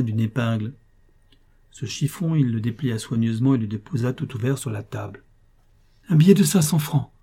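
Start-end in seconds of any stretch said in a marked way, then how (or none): 6.1-7.1 astonished outcry, higher and louder; stunned exclamation. A narrator's reading. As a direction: gasp it out in disbelief. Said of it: "s'exclama Rose stupéfaite".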